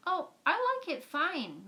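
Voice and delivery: a hint of a gentle schoolteacher; Catherine's voice. A narrator's reading. Catherine voiced in read story.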